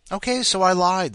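spring the twist with a bang; ironic reveal. Acted. Narrator revealing the twist, loud and high.

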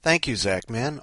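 ordinary talk

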